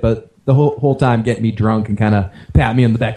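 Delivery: ordinary delivery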